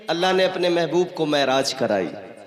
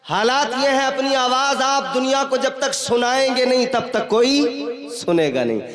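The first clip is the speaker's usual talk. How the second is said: raised, urgent preaching voice; rousing exhortation; 7 semitones higher, 5 dB louder than usual. Real, not acted. Preacher urging audience to make their voices heard, exhortation. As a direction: press the crowd for a hearty response.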